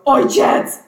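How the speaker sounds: loud, sharp call to dad; calling for attention. Acted. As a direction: call out suddenly to get his attention. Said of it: "That's how I get his attention".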